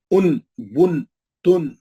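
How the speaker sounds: teacher showing rounded lips on ان بن تن; tanween drill. Shown as a demonstration. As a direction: call out ان، بن، تن with lips kept round. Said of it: "پیش پڑھے تو خود میں ہونٹ گول رہنا".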